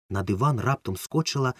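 ordinary delivery

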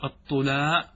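"الطلا" said slowly as tula, with damma on the ta; الطلا بضم الطاء. Shown as a demonstration. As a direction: say "الطلا" as ṭulā, the ta with u. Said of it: "وأما الطلا بالضم".

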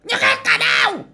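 very high, loud scolding shout; angry mother yelling. Retold or imitated. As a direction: yell at the kid to shut up. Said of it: "bà già mày".

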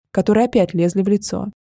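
ordinary delivery

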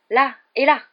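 high, loud pointing out; excited discovery. A narrator's reading. Harry points out burn marks.

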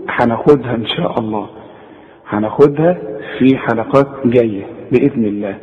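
usual speaking voice

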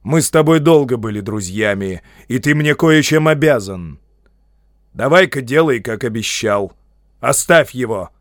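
loud, firm appeal to a friend; forceful, insistent demand; stern urging. A narrator's reading. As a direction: press the point with authority. Wizard sternly presses hobbit to obey.

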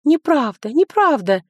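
put-on high, loud child's protest; shouting. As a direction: cry out in denial. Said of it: "закричала Иля".

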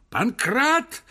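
high loud call for the servant; furious yell. Acted. Professor furious, yelling for Pankrat. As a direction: shout Pankrat in a rage. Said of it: "закричал профессор в бешенстве".

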